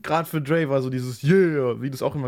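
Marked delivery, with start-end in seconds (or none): 1.2-1.8 drawn-out [name] yeah. Retold or imitated. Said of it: "Immer das Langgezogen auch".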